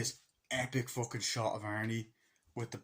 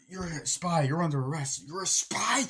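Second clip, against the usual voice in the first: mock accusing wife; 6 semitones higher, 7 dB louder than usual. Accusing husband.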